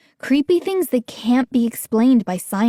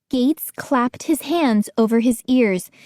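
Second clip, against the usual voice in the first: usual speaking voice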